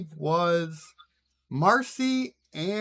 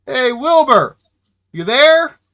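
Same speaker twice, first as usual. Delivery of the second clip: loud call through a door; 9 semitones higher, 14 dB louder, slower than usual. Acted. Ned knocking at Wilbur's shack door, calling out. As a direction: holler to see if anyone's home.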